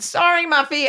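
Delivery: a hint of toddler sweetness; mock toddler apology. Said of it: "she said".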